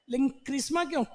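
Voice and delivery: usual speaking voice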